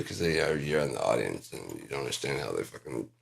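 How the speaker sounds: ordinary delivery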